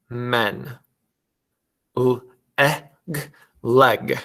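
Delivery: each letter sound alone with pauses, then the blended word, as in "h, eh, n, hen"; segment, pause, then blend each word for the class. Shown as a demonstration. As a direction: phonics drill with short "eh" vowels. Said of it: "I'm gonna give you the sounds for each word".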